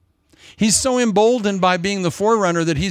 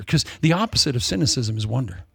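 ordinary talk

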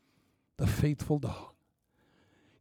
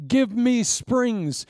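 high, loud, slow plea; preaching climax; 10 semitones higher, 6 dB louder, much slower than usual. Acted. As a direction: call it out big and slow.

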